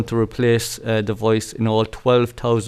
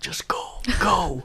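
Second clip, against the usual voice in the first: whispering; 6 semitones higher, 5 dB quieter than usual. Pretend. Conspiratorial urging to leave.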